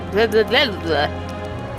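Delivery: real tongue-tied blah-blah; flubbed-line babble. Real, not acted. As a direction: babble after tripping over the line. Reader flubs a line mid-read.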